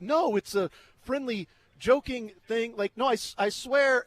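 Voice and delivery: protesting to the people nearby; pleading protest. Retold or imitated. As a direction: insist it's just a joke. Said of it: "And I was like".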